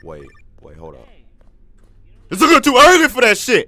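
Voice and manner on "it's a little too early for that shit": startled shout. Real, not acted. Jump scare in game.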